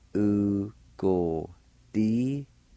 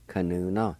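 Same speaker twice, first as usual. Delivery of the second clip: ordinary delivery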